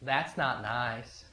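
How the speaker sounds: put-on soft and slow, a little boy gently scolding; gentle child scolding. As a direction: say it quietly and slowly, like a small child correcting adults. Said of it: "And he says".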